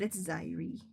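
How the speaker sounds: Zyri as ZY-ree, slowly; slow down on Zyri, long "eye" first; author's pronunciation. Shown as a demonstration. Author corrects readers, demonstrated pronunciation of Zyri. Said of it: "how I pronounce it".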